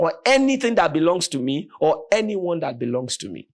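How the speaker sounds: mother rebuking the robber; defiant retort. Retold or imitated. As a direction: refuse to be touched.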